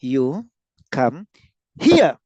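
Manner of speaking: word by word, punching "here"; stress on HERE. Shown as a demonstration. Teacher meaning here not there, HERE punched. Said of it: "Stress on here".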